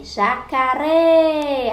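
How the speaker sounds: "Jacaré" called out with RÉ drawn out; "Jacaré" like a call, long last syllable; calling out the word. Shown as a demonstration. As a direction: hold the RÉ of "Jacaré", loud and high. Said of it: "a que mais você demorou pra chamar".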